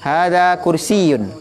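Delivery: slow Hadza kursiyun with a doubled yy; teacher stressing the shadda in kursiyun. Shown as a demonstration. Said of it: "ditasydidkan di situ".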